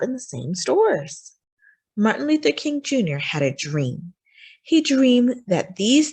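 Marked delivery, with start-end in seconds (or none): none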